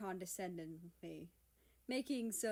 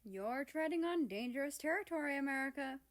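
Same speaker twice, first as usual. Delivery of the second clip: higher, teasing warning; 6 semitones higher, 4 dB louder, faster than usual. Acted. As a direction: warn him off playfully. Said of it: "Morningstar warned".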